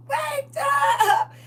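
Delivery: screaming at top of lungs. Retold or imitated. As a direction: shriek it with all your breath. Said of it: "yell at the top of her lungs".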